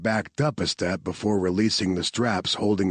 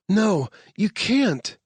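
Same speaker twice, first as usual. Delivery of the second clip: urgent protest; 13 semitones higher, 3 dB louder, slower than usual. Acted. Claire protests.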